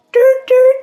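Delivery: loud, high two-note whoop; imitated police siren. Police stopping the driver, imitated siren blips. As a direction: cut in with a piercing siren beep.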